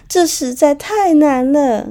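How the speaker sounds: high, slow imitation of a crying child's whine; mock crying child. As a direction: whimper it like a sobbing kid, drawn out. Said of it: "结果孩子就大哭". (模仿孩子哭腔，拖长着说)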